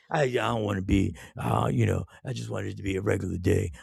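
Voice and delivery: low, soft mumbled impression; reluctant birthday boy. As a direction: mimic a friend shying from fuss, low and quiet. Voicing the birthday-shy friend's protests.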